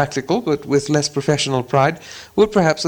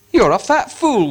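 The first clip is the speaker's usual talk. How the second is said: higher angry troll insult; 8 semitones higher, 3 dB louder than usual. Acted. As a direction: sneer it at him. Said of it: "said Bert".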